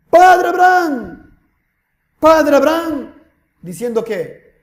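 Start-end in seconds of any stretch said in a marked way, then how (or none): 0.1-3.1 slow, high cry, calling out loud. Acted. Tormented rich man calls on father Abraham, crying out. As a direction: wail the name out to someone far away. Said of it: "dando voces, clamando".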